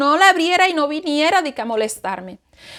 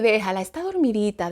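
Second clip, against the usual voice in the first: put-on low, soft voice telling the child to let her sleep; a hint of a hushing father; lightly voiced father, low and soft; hushing husband; 4 semitones lower, 6 dB quieter than usual. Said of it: "y él me tocaba así".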